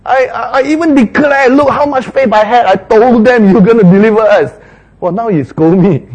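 high, loud aggrieved protest; indignant Moses. Acted. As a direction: protest that you did everything right. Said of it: "It's almost like".

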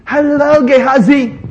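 high, sing-out mock hello; friendly greeting. Neighbour greeting Gehazi on the road, put-on friendly call. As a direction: hail him from across the way, pitched high. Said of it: "someone greet him".

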